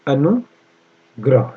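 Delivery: very slow Anugrah, anu... grah; long pause in mid-word; syllable-by-syllable dictation. Shown as a demonstration. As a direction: dictate Anugrah in two pieces. Teacher dictating spelling slowly.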